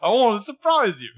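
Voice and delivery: high, loud hammed-up Australian accent; mock Australian accent. Co-host keeps mimicking Jordan on request, high mock Australian accent. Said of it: "keep doing it".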